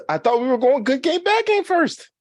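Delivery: high, rising disbelief; surprised protest. Real, not acted. Host confused, protesting.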